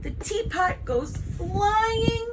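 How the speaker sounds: high, slow, stretched-out narration; slow, rising dramatic dread; suspenseful slow motion. A narrator's reading. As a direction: draw out the last word as it sails away. Disaster unfolds in slow motion.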